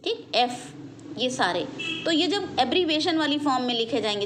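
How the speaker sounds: ordinary talk, nothing marked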